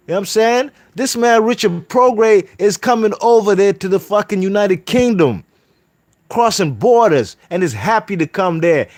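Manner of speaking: loud, high heated rant; real fired-up shouting rant; angry rant. Real, not acted. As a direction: tear into him at full volume. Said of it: "I'm getting pissed".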